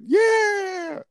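very high, drawn-out mock cheer; re-enacted cheer. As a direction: cheer like leading a room of kids. Re-enacting cheer taught to kids.

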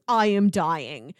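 usual speaking voice

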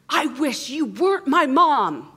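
high, loud, drawn-out wail; imitated child's outburst. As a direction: act out a small child's tantrum. Imitates upset six-year-old son.

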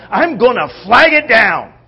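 loud, high determined cry; top of his lungs. Acted. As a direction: belt it out, resolved not to miss the chance. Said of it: "begins at the top of his lungs".

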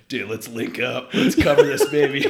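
mock bro enthusiasm; hyped buddy. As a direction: pump up your fishing partner. Retold buddy hype.

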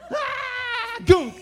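high laughing yelp then loud crash noise; hammed-up childish shriek and thud; kid's yelp and crash. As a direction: squeal like a running kid, then bonk. Imitating kid crashing.

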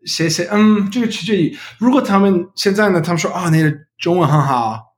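American-accented learner Mandarin; careful second-language Mandarin, English accent; foreigner speaking mandarin. Real, not acted. Non-native learner speaking Mandarin throughout.

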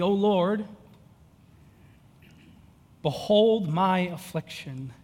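slow, solemn reading of a lament; scripture reading. Acted. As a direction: read each line slowly and gravely.

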